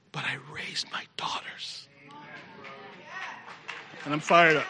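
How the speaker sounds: soft unvoiced whisper. Real, not acted. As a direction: hush it as a confession. Quiet emotional confession.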